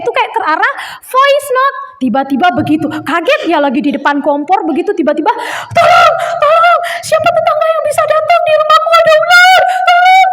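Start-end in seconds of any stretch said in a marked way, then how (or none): 5.8-10.3 imitating a woman's high, loud cry for help; teriak minta tolong. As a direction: scream it like a panicked neighbour on a voice note. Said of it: "Itu seorang ibu teriak-teriak begitu". (menirukan ibu berteriak panik minta tolong)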